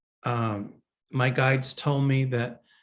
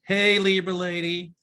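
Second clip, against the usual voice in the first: higher, sing-song hello to a viewer; playful greeting; 6 semitones higher, 3 dB louder than usual. Pretend. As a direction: greet her like an old friend, with a lilt. Greeting the asker with flourish.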